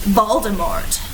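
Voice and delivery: ordinary delivery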